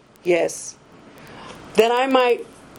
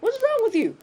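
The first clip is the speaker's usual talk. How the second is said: calling after her; 11 semitones higher than usual. Retold or imitated.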